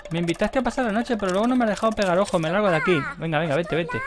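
high mock-indignant voice; indignant guest. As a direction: storm off as the sleepless guest. (voz aguda de invitada indignada)

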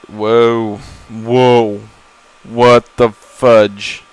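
a slow, loud what the fudge; startled exclamation. Real, not acted. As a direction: blurt it out in slow shock. Startled by the game world.

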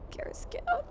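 high mock-desperate plea to a fallen friend; high pleading cry. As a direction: beg him up in a high voice. Begging downed squadmate.

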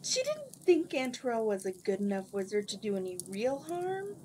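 usual speaking voice

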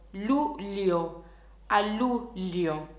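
ordinary talk, nothing marked

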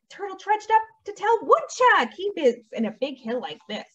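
lively, sing-song storybook delivery; animated picture-book narration; story reading to children. A narrator's reading. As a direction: read it to little ones with big pitch swings.

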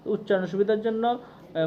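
ordinary talk

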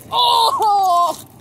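sudden loud cry of pain. Real, not acted. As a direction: yelp as the bike hits you. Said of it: "Just got sack whacked by my own bike".

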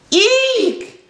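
very high acted shriek; high-pitched startled elephant; shouted eek. A narrator's reading. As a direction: squeal in cartoon fright. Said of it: "shouted the elephant".

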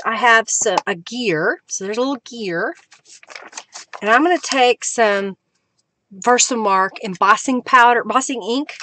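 usual speaking voice

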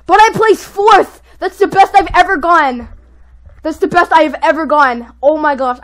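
loud, high, elated exclamations; thrilled shouting. Real, not acted. Player just placed fourth, thrilled shouting. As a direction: shout it in amazed delight.

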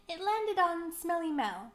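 ordinary delivery, nothing marked

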